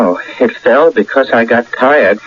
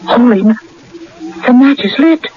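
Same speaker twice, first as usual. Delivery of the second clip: higher, rushed gasp of amazement; astonished; 11 semitones higher, faster than usual. Acted. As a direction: blurt it out in disbelief. Match lights by itself.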